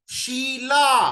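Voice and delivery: very slow, drawn-out शीला; sounding out शीला. Shown as a demonstration. Teacher sounds out शीला slowly for parents. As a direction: stretch शीला so शी stands out. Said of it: "पहला word क्या आ रहा है".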